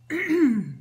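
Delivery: sharp loud clearing of throat. Real, not acted. Throat hit by emotion.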